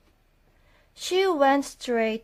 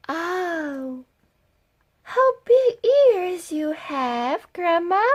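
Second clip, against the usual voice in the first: higher, slow wondering; little girl; 8 semitones higher than usual. Acted. Girl marvelling at grandma's legs, higher and slow. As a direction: ask slowly like a curious child.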